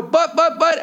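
high, puffed-up mock sputtering; angry protest. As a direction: act out an indignant attempt to cut in. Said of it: "I get so angry and puff up".

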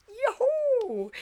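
very high gleeful whoop; excited whoop. Retold or imitated. Girl expecting cash from grandmother's gift, very high whoop. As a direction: cheer like you just got cash. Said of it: "I was so excited".

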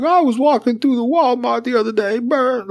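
high raspy comedian voice; squeaky high Tracy Morgan voice; Tracy Morgan impression. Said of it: "Tracy Morgan's the same thing. He's like".

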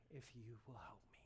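hushed tearful prayer; whisper. Retold or imitated. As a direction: barely breathe it out. Retold broken prayer.

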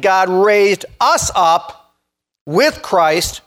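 deliberate, drawn-out scripture reading; slow, emphatic. Real, not acted. Preacher points out the repeated phrase in scripture, slow and emphatic. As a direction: give every word its own weight, slowly. Preacher drives home a verse.

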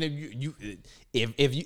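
ordinary talk, nothing marked

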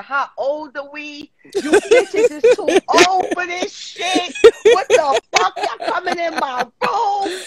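slow, high-pitched mock outrage; retold fury. Retelling woken friend scolding the intruders, exaggerated fury. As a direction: draw out each indignant word, imitating her. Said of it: "This bitch was furious".

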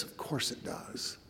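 very soft, slow near-whisper; hushed gentle answer. Acted. Grace answering Fear's denials, hushed and gentle. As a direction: answer slowly, dropping almost to a whisper.

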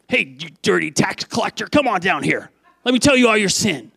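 hammed-up accusing summons, rising at the end; mock scolding. Preacher acts out the Jesus we would expect berating a tax collector, mock scolding. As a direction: call the sinner down harshly, building.

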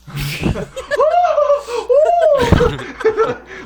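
high, loud, crying laughter; loud howling laugh. Real, not acted. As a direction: lose it completely laughing. Bursts out at the joke.

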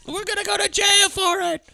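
very high squealed voice; mock hysterical wail. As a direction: squeal it like a panicking accomplice. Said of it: "fucked it up by screaming so much".

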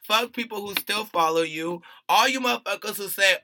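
loud, emphatic denunciation; angry rant. Real, not acted. Podcaster cursing out a rapper and his followers, angry.

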